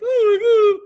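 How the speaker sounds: high, loud mocking wail. Mocking the girl's friends with a shrill loud ooh.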